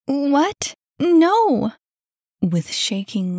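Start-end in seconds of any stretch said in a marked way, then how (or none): 0.1-1.7 high, loud, emphatic protest; incredulous denial. Acted. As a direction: deny it in disbelief. Annie denies causing the drop.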